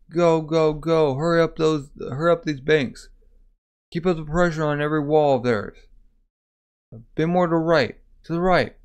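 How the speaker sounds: mildly acted battle orders; urging orders.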